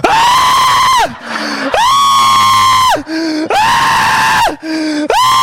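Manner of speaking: loud, repeated frustrated ahh; frustrated yells. Retold or imitated. Husband yelling in frustration after wife refuses. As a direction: shout out pure frustration.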